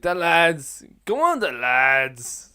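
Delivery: big slow catchphrase call; rallying cheer. Shown as a demonstration. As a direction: egg on the lads, stretching the words. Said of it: "That type of stuff".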